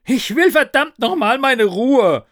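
high, loud, furious yell; angry scream. Acted. Narrator yells at nagging ghost for peace, angry scream. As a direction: yell it out, at the end of your patience. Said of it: "schrie ich".

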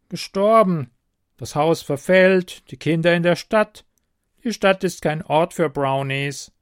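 goblin voice. A narrator's reading. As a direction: tell the sad news like a gruff goblin. Goblin tells of its master.

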